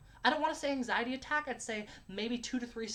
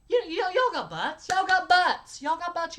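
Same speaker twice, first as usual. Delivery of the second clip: loud, high-pitched shout at the audience; mock outburst; 7 semitones higher, 9 dB louder, slower than usual. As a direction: holler it up high, stretching the first words. Mock outburst defending butt talk.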